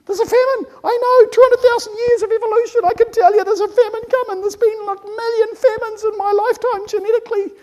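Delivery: high monotone, loud alarmed voice; insistent genetics. Acted. Genetics warning of a coming famine, high alarmed voice. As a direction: sound the alarm on one high note. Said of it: "Our genetics keep telling us".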